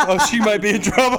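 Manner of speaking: laughing punchline. Real, not acted. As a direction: squeeze the words out while cracking up. Cracking up after the punchline.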